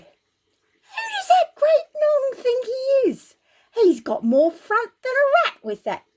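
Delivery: Dave bellows in disbelief at the giant; indignant cry. Acted. Dave scoffing at Goliath's boast, high outraged yell.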